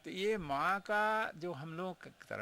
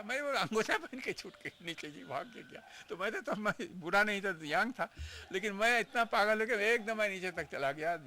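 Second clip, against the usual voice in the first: higher and louder, tumbling out with joy; excited retelling; 6 semitones higher, 5 dB louder than usual. Retold or imitated. Devotee recalls running half-dressed to mother, excited. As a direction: relive the giddy rush down to mother.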